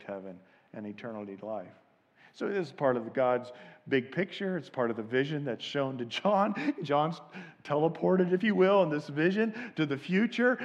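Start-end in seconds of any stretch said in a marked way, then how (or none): none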